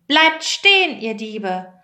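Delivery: high, forceful reprimand in a storyteller's voice; stern shout. A narrator's reading. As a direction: call out sternly, accusing thieves. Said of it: "rief er streng".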